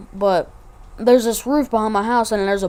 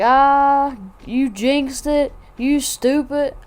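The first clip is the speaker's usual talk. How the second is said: imitating a friend's high, drawn-out teasing; joking jeer; 6 semitones higher, much slower than usual. Imitating friend teasing about the jinx, joking jeer. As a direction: rib him slowly in a raised pitch. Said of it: "we always joke like that".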